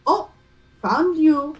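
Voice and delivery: put-on French accent. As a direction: coo to the ball like a pet, French style. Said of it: "Bella's accent work is chef's kiss".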